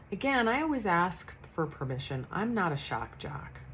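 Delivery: ordinary talk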